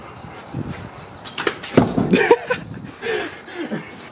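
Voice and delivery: breathy high wheezing laugh; unvoiced laugh. Real, not acted. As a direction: laugh silently until it wheezes. Laughing at his own joke.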